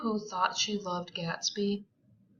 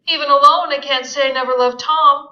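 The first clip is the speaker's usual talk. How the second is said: pitiful; 6 semitones higher, 13 dB louder, faster than usual. A narrator's reading. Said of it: "she admitted in a pitiful voice".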